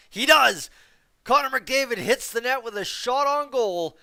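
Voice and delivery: high, very loud hockey call; excited play-by-play shout. Real, not acted. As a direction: shout it like a huge moment just happened. Live call of a shot on goal.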